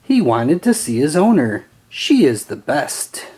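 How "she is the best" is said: plain reading voice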